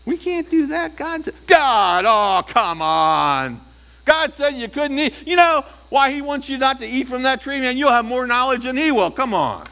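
high, loud scoffing serpent voice; mock God as the serpent, high-pitched and forceful. Preacher plays the serpent tempting in Eden, high mocking voice. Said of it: "Now remember the serpent in the Garden of Eden? It mocked God".

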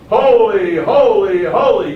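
loud, drawn-out threefold proclamation; booming praise round the throne; seraphim crying holy. Acted. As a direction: call out each holy with full force. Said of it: "seraphim around the throne saying".

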